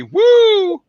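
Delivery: high, loud "woo"; mock woo. Real, not acted. Soldier joking about infantry fun, mock cheer.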